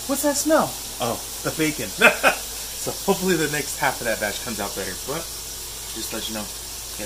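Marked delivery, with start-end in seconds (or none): 0.1-0.7 high, loud startled cry; sudden alarm. Retold or imitated. Cook noticing bacon burning, startled loud cry. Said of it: "and I'm like". 1.9-2.7 burst of laughter. Real, not acted. Laughing at burnt bacon.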